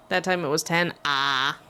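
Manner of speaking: ordinary delivery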